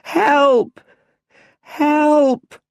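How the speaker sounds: high, loud, drawn-out cries for help; desperate shouting. A narrator's reading. Little Bruce curled up under the bats, high, loud, desperate. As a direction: shout as the bats swarm him. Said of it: "He makes himself into a ball and shouts".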